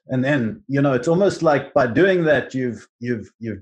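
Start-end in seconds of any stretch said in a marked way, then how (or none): none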